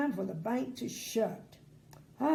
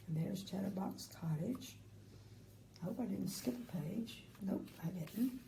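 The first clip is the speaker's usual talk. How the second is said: quiet aside; 3 semitones lower, 10 dB quieter than usual. Real, not acted. Quiet aside about the page.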